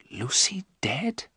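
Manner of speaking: slow, stunned whisper; quiet shock. A narrator's reading. Jonathan hears of Lucy's death, whispering in shock. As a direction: whisper it slowly, in disbelief. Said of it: "Jonathan said quietly".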